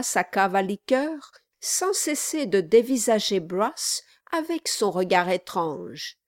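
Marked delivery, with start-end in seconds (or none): none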